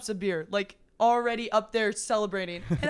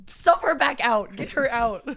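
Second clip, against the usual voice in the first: mock yell; 5 semitones higher, 7 dB louder than usual. Fans yelling at coach.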